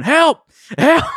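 high, loud mock scream of help; hammed-up panicked yell from the bathroom; imitated cry for help. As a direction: squeal it like a panicked kid. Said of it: "He's going".

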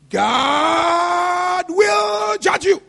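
slow, stretched preaching cry of judgment; drawn-out cry. Acted. Preacher crying out judgment to the church, slow and drawn. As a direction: wail it out slowly over the congregation.

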